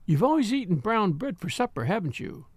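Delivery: usual speaking voice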